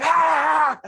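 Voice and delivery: loud high startle yell; jump-scare the baby with a shout; sudden scare shout. Retold or imitated. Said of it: "and I went".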